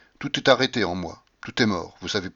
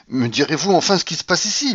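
higher, insistent cry; exclaiming; 5 semitones higher, 4 dB louder than usual. A narrator's reading. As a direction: cry out, demanding an answer. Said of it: "m'écriai-je".

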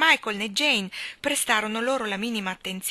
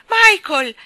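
very loud, high shout; urgent cry; 11 semitones higher, 16 dB louder than usual. A narrator's reading. Jane shouting to Michael as she grips his arm. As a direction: grab his attention at once. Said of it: "gridò Jane".

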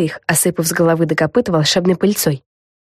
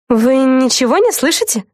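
higher-pitched character question; asking; 8 semitones higher than usual. A narrator's reading. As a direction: ask it in a raised, higher voice. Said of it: "снова спросила Флафанора". (высокий вопросительный голос)

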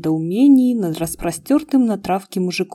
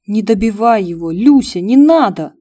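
tearful pleading; 2 semitones higher, 8 dB louder than usual. Acted. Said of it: "слёзно взмолился Эмма".

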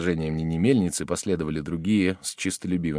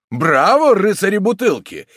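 high, loud bravo; cheering shout; 13 semitones higher, 9 dB louder than usual. A narrator's reading. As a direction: cheer them on. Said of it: "кричали одни".

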